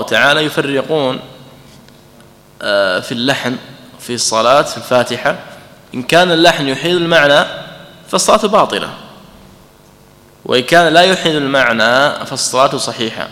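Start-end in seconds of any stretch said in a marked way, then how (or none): none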